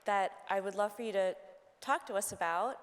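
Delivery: usual speaking voice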